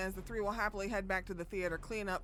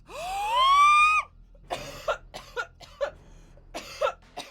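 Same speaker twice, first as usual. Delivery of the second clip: loud high gasping shriek; huge shocked gasp; 13 dB louder than usual. Real, not acted. Streamer reacting to the plot twist with a shocked gasp. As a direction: gasp as if the floor dropped away.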